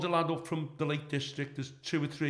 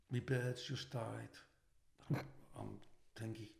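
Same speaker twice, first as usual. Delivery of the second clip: softer, slow, mock-mournful; pretend grief; 5 semitones lower, 12 dB quieter, slower than usual. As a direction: fake heartbreak to dodge a fight. Faking grief to escape a beating.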